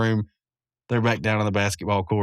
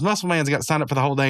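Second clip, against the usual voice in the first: quick excited; 4 semitones higher, faster than usual. Retold or imitated. Rigby rushing.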